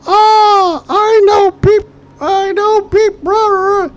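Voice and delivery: hammed-up shrieking rant; very high, loud mock tirade; Morozumi screaming. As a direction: scream it like a bleeped-out outburst. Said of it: "jumps up and starts screaming".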